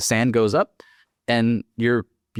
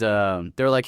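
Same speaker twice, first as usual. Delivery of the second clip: ordinary talk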